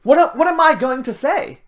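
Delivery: hammed-up worried protest, high and halting; protesting Moses. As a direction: imitate someone flustered and making excuses. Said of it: "And Moses was like".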